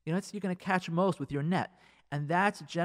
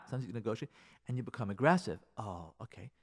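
usual speaking voice

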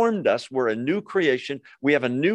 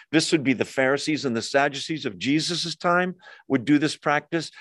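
ordinary delivery

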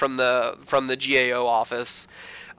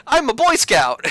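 much higher, eager volunteer voice; mock overeager yes-man; eager overkeen Sam; 11 semitones higher, 4 dB louder than usual. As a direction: squeak it out like a teacher's pet. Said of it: "Sam's like".

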